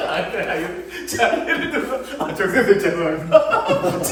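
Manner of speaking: helpless real laughter while talking; cracking up mid-sentence; laughing. Real, not acted. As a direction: try to carry on through the laughing fit. Amused by the student's answer.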